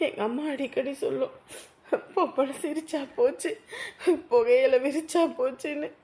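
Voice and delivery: bitter tearful laugh. Acted. Same girl, still weeping. (கண்ணீருடன் கசந்த சிரிப்பு)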